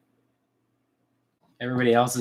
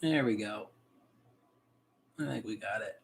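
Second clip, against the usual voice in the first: tight, breath-held murmur; holding breath; 3 semitones lower, 8 dB quieter than usual. Real, not acted. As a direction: speak while still holding your breath. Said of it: "and I'm holding my breath".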